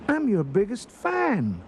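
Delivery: high-pitched, eager fan voice; Ralph. A narrator's reading. Ralph meeting Eduardo, high and eager. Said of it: "replied Ralph".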